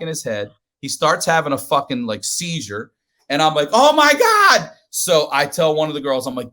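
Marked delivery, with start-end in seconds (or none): 3.7-4.7 high, loud alarmed gasp of words; panicked cry. Retold or imitated. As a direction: sound scared as he seizes. Cousin starts having a seizure.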